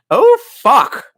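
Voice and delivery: high, loud burst; sudden cry of alarm; loud exclamation. Pretend. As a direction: blurt it out high and hard. Sudden exclamation while reading.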